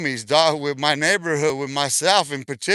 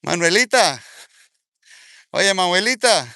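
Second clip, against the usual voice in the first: raised, sing-out call of a name; mock calling out; 3 semitones higher, 4 dB louder than usual. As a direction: call out as if hailing someone. Mockingly calls out to absent rival.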